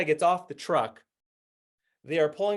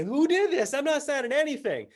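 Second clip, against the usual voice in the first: high-pitched mock outrage; acted-out indignant protest; indignant passenger; 10 semitones higher than usual. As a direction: complain in a raised, affronted pitch. Acting an outraged passenger complaining.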